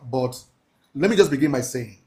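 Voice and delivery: normal talking voice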